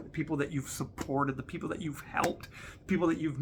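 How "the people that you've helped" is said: normal talking voice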